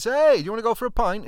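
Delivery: high, loud shout over the road; calling out across the street. Retold or imitated. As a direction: hail a mate from across the street.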